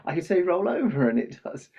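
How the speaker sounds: put-on high-pitched, eager boy showing off his stick; boy playing dog trainer, calling out commands; excited little boy. Boy explains his stick is good, excitedly.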